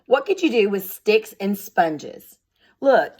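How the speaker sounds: usual speaking voice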